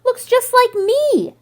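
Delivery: slow triumphant shout from the treetop. A narrator's reading. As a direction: keep shouting, drawn out and proud. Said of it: "he shouts".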